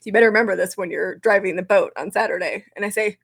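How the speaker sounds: usual speaking voice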